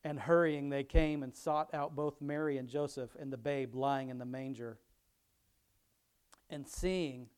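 scripture reading. Acted. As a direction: read the passage out to the congregation.

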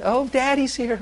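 put-on high, cheery child greeting; little child's voice. Father imitating a toddler greeting him in a high voice. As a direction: chirp it like a happy toddler.

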